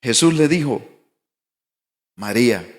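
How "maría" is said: lower, gentle call of her name; tender naming. Retold or imitated. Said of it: "¿Cuándo entendió el tono?".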